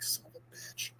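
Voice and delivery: under-breath whispered curse; whispered son of a bitch. Retold or imitated. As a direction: hiss it under your breath, beaten. Recapping comic, Edie curses.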